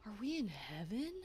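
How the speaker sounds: soft, lightly voiced wonder; mildly acted hushed question; awed movie character. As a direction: ask it in a hushed, naive way. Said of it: "and he's like".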